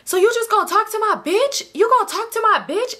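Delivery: high-pitched confrontational demand; hammed-up outraged questioning; retold angry yelling. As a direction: imitate an indignant man, voice jumping high. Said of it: "He starts yelling".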